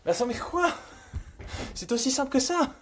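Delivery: high, loud astonished outbursts; stunned disbelief. Real, not acted. Coder amazed the server answers so simply, stunned disbelief. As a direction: escalate from incredulous yelps to a shout. Stunned that the app works first time.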